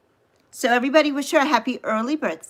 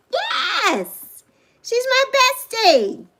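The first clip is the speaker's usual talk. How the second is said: much higher and slowed; gushing excitement; 10 semitones higher, much slower than usual. Real, not acted. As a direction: cry out with pride. Introducing best friend to viewers.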